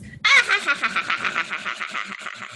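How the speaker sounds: high cackling witch laugh. Shown as a demonstration. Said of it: "So it goes like this".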